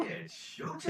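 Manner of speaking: breathy unvoiced whisper of the catchphrase; whispered line. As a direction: whisper it like a secret teaser. Repeating the game's catchphrase.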